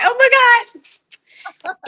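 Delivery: a stretched, very high oh my God, choked up; real tears welling up, voice climbing; fighting back tears. Real, not acted. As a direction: hold back real tears, drawn out slowly. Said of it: "I'm trying not to cry".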